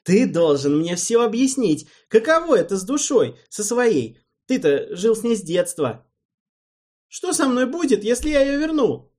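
loud, high insistent pressing; angry demand. A narrator's reading. Alvin angrily demands answers from Erald. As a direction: push him hard for answers. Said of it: "снова начал злиться".

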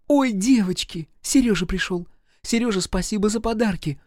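put-on high, delighted girlish greeting; joyful cry. A narrator's reading. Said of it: "закричала радостная Ира". (радостный возглас)

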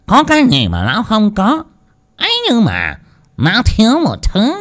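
high, teasing slurred voice; drunken drawl. A narrator's reading. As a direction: drag it out drunk and sly. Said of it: "lè nhè".

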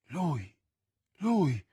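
usual speaking voice

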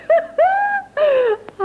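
a high wailing sob-laugh; sobbing laugh. Acted. Woman bursting into a high wailing sob-laugh.

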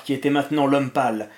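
ordinary delivery

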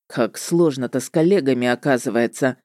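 low-pitched grumble to himself; grumbling witcher. A narrator's reading. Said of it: "под нос себе буркнул он".

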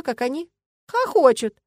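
usual speaking voice

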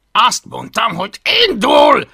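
loud, high, irritated command; edgy repeated order. A narrator's reading. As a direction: insist at a shout, losing patience. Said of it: "ismételte Hablaty idegesen".

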